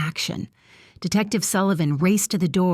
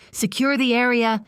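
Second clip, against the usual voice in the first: higher, barked commands; barked orders; 7 semitones higher than usual. Acted. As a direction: bark it out with authority. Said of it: "calm, authoritative tone ... as he barked".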